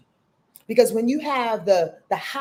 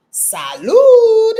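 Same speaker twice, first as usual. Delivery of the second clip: high, loud toast cry; exuberant toast; 12 semitones higher, 11 dB louder than usual. Real, not acted. Toasting Saturn before a drink, exuberant shout. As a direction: belt it out in a high, celebratory call.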